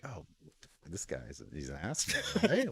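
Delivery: quiet mock muttering under the breath; a private, quiet grumble at a rude stranger; muttered thought. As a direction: grumble it to yourself, low and soft. Inner thought about rude man.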